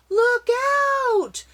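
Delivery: high, loud warning yell; shouted. Acted. Mark warning the others on the rushing river, a loud high shout. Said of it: "shouted Mark".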